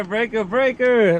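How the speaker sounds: slow, drawn-out radio call sign. Shown as a demonstration. Dad showing daughter how to call on the CB radio. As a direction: say it like a trucker on the CB. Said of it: "Like that, girl".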